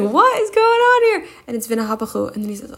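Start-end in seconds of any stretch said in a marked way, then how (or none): 0.0-1.3 high, loud and baffled, a mock double take; imitating someone thrown by what they see, pitched way up; baffled surprise. Hashem reacting to the forced joy, high baffled surprise. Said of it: "And Hashem's like".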